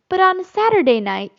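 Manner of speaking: sly coaxing suggestion; insinuating. A narrator's reading. Slightly slyly coaxing the mother to dance. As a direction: slip it in slyly, nudging. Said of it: "Slightly insinuated".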